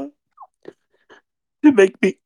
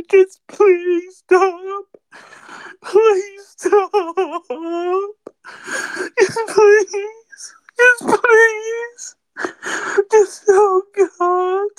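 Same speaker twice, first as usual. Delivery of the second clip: slow, higher, hammed-up fake crying; mock sobbing plea; 4 semitones higher, much slower than usual. Begging his friends to stop, fake sobbing. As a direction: beg them to stop through fake sobs and laughs.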